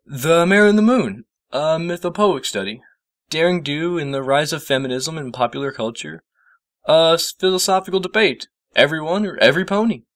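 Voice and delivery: put-on lightly high boyish voice; reading titles aloud, a bit puzzled; Spike reading titles. A narrator's reading. Spike reads the book titles, lightly high boyish voice.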